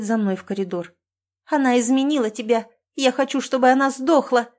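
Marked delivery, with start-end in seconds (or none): 1.4-4.5 louder and higher, raging cry; shouting. A narrator's reading. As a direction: yell it with hatred. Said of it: "кричит она". (крик ненависти)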